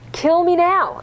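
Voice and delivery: high, loud demand to die; Jonah's angry cry to God; furious outburst. Acted. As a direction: fling it at God in rage. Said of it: "He uses them accusingly at God".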